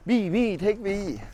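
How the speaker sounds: high pleading sheep voice; begging to be taken. Retold or imitated. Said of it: "be like".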